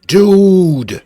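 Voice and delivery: a real furious outburst at the screen; furious shout. Real, not acted. Streamer yelling at a game stuck in French, furious shout.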